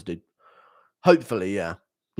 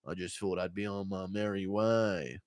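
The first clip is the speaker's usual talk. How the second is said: lightly voiced JDM; 6 semitones lower, 10 dB quieter than usual. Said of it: "J D M and Goddard arguing".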